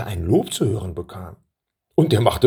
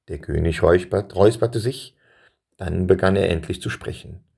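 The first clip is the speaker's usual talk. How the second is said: usual speaking voice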